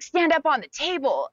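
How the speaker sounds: high, insistent mock command; imitating the director's order. Director orders the crying new girl onto the table, insistent. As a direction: bark it up at her like a camp boss. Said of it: "he's like".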